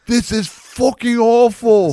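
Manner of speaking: slow, very high, loud imitated tirade; mock-furious chef yelling at owners; Gordon Ramsay. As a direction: bellow it in hammed-up outrage. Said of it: "Gordon Ramsay just was like".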